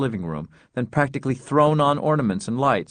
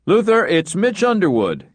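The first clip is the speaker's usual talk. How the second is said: higher, louder booming greeting; 9 semitones higher, 4 dB louder than usual. Acted. Mitch calling Luther on the phone, booming. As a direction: boom it out like a hearty neighbor. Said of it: "came a booming voice".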